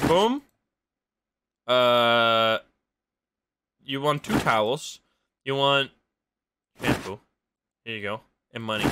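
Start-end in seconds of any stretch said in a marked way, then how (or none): none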